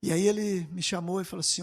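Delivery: normal talking voice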